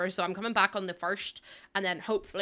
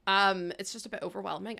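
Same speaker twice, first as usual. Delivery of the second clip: ordinary talk, nothing marked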